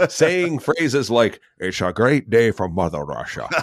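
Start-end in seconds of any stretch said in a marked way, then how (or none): 1.6-3.5 put-on a Scotsman's stab at a Russian accent, laughing through it; mock Sean Connery playing a Russian. Recounting a film line in a Scottish-Russian accent. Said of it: "performing as a Russian".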